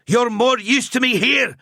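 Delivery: high, loud shout. A narrator's reading. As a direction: shout him down firmly. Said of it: "Gruber shouted".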